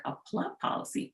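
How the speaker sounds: soft, breathy, near-whispered repeat of "A plup policy?"; incredulous echo. Retold or imitated. Wife on the phone repeats the HR rep's odd term, quiet disbelief. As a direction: echo the strange phrase back in quiet disbelief. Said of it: "I'm like".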